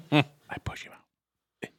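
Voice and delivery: slow guilty whisper. Pretend. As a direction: whisper it slowly, sneaky. Sneaky confession to the GM.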